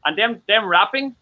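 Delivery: normal talking voice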